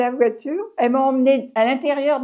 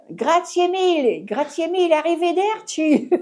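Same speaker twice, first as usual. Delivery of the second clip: mock Italian thanks; 5 semitones higher than usual. To the policeman, posing as an Italian tourist, mock grateful. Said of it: "J'ai pas parlé français".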